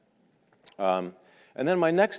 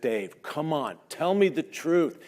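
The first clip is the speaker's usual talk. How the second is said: pleading; 7 semitones higher, slower than usual. Retold or imitated. Pleading with astronaut Dave.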